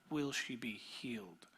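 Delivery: breathy hushed whisper of a fearful hope; whispered worry. Pretend. Pastor voicing a listener's anxious question, whispered. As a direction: whisper it like a private prayer. Imagined inner plea.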